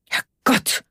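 hushed-voice exclamation of shock; startled. A narrator's reading. Onno after nearly crashing the car, startled. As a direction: gasp it out, startled. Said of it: "Erschrocken sah er zu Karl".

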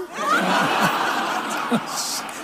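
a burst of high unvoiced laughter; giggling laugh; high breathy laugh. Real, not acted. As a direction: laugh at your own joke. Laughing at own punchline.

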